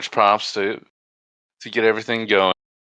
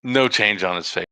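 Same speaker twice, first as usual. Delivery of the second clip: ordinary delivery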